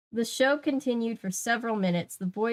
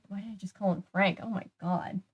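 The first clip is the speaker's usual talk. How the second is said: ordinary delivery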